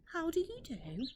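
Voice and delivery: a bright cheeky little duck; polite duck. Acted. Duck greets the rat.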